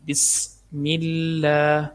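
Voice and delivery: بسم with a hissing س and slow thin اللہ; whistling sin. Shown as a demonstration. Teacher showing the whistling sin, slow recitation. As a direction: let the س in بسم hiss. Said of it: "سیٹی کی آواز آئے گی".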